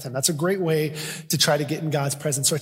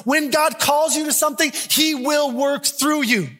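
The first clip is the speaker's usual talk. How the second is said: higher, rising sermon intensity; full-force preaching; 7 semitones higher than usual. Acted. Pastor urging the congregation that God can use them, fervent preaching. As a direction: preach it out, building to the altar call.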